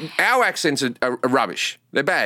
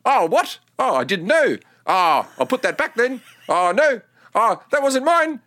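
put-on higher, bewildered New Zealand accent; confused New Zealander; 7 semitones higher than usual. New Zealand sketch character muddled by everything, New Zealand accent. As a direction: play a baffled Kiwi, pitched up, in short puzzled bursts. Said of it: "any sketch that involves New Zealanders will go along like this".

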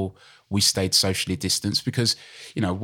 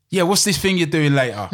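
mock Cockney voice; 7 semitones higher, 4 dB louder than usual. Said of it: "really deep and Cockney voice".

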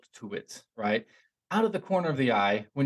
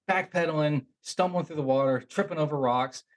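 ordinary delivery

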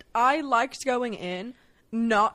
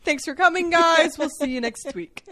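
mock podcast outro; 5 semitones higher, 6 dB louder than usual. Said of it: "They just, you know".

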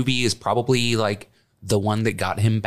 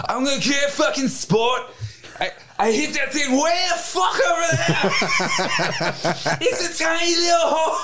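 put-on much higher, shouted Scottish accent through laughter; Robin Williams' Scottish. Robin Williams' golfer ranting about golf in a shouted Scottish accent. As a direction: yell it like Robin Williams' drunk Scotsman. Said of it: "you doing the Scottish accent".

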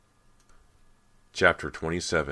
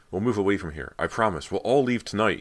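raised, urgent pleading; desperate cry; 5 semitones higher, 6 dB louder than usual. A narrator's reading. Brant promising Callie his family will leave, desperate. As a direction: bargain desperately, voice lifted. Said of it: "he cried desperately".

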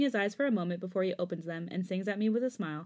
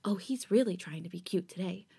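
breathy whispered inner thought; whispered aside. A narrator's reading. Listener admiring the singer as he performs, whispered aside. Narrator's private thought in story.

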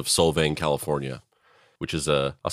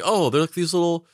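naive cooing; 6 semitones higher than usual. Retold or imitated. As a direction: coo over cute birds. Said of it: "you're like".

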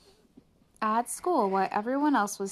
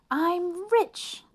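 gleeful; 11 semitones higher, 5 dB louder than usual. A narrator's reading. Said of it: "thought Arthur".

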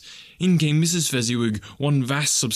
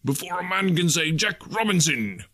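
Fezziwig voice; 6 semitones higher than usual. Acted. Fezziwig hurrying boys.

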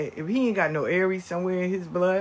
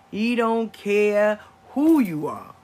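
louder, slow, every word punched; fired-up declaration; 4 semitones higher, 9 dB louder, slower than usual. Real, not acted. Grandma bragging to viewers, loud and slow. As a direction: hype up the little fighter. Hyped about grandson's fighting spirit.

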